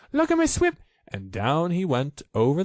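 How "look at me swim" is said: very high, loud squeaky little voice; Roo squeaking. A narrator's reading. Roo showing off his swimming, high squeak. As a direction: squeak it like a tiny proud joey.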